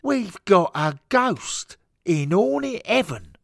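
slow, high, very loud announcement; alarmed bellow. Acted. Steward breaks the news of a ghost, alarmed bellow. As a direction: cut in with a drawn-out holler.

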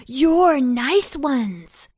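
loud, high, slow reproach; panting. A narrator's reading. Cabbages catch up with Pumpkin, panting reproach. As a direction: drag the words out loudly as if winded. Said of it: "panted the cabbages".

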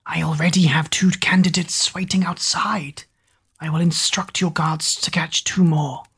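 hushed fawning servant; whispery servant. Acted. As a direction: whisper it obsequiously. Googe's voice.

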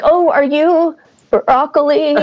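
high, slow, faltering question; flustered, sweaty nerves. Retold or imitated. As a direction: act out barely getting the words out. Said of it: "all I could get out in my, like, sweaty state".

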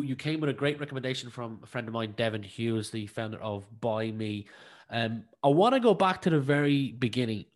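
ordinary talk

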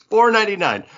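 amused disbelief at what it cost; laughing. Real, not acted. Comic host laughs while reading the cover price. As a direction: laugh out the price, high and loud.

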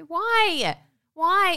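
high, loud protesting cry; mock wail. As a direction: wail it in exasperated despair. Mock despair, whisper bursts into wail.

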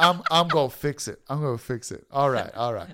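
a lightly voiced cool, unhurried Snoop; [name] impression. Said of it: "He's like".

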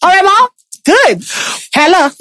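high-pitched check-in with mom; switching into character. Retold or imitated. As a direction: pitch it up, playfully reassuring mom before slipping back. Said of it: "Then I'll just slip back".

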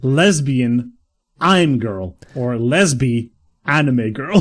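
slow, deliberate reading; slow spelling out. Shown as a demonstration. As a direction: sound it out slowly.

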